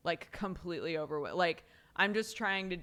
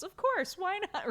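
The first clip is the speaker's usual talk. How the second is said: mock cheerful friend; 13 semitones higher, 5 dB louder than usual. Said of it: "friends who are like".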